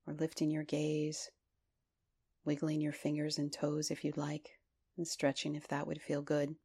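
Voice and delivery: calm voice leading a body scan; guided meditation. Acted. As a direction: ease them gently through the body.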